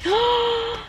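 excited gasp. Real, not acted. Gasp at toy.

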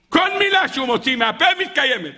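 high, exclaimed decree; emphatic proclamation. A narrator's reading. Preacher repeats the Baal Shem Tov's decree, emphatic proclamation.

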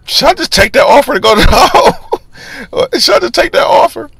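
second-guess yourself in a thin high voice; nervous inner voice. Retold or imitated. Weighing the offer to hide in the hole, high nervous inner voice. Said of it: "I'm thinking like".

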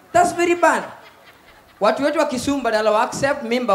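ordinary talk

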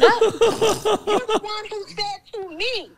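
slow, loud and laughing. Real, not acted. Telling how he paid, laughing loud and slow. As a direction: drag it out through the laugh. Laughing while retelling the car deal.

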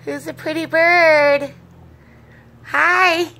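high, loud sing-song phrase; teaching a parrot. Real, not acted. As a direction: drill the words up high for the bird.

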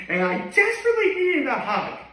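high, laughing whine. Pretend. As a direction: break into a laugh. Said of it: "I'd be like".

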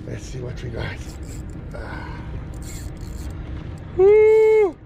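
ordinary talk, nothing marked

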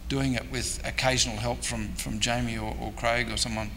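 ordinary delivery

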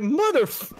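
high-pitched mock-startled curse; retold alarm. Player recounting thinking they were getting attacked, mock alarm.

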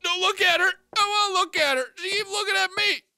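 high, tearful protest; panicked whine. Real, not acted. Player stared at by the ghost, panicking. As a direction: whine it high, near tears.

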